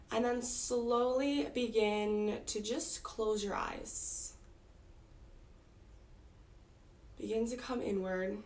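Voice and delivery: unhurried meditation guide, long pauses between phrases; guided meditation. Real, not acted. Teacher guiding the group to close eyes and notice breath, calm guided meditation. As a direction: ease them inward, leaving space after each instruction.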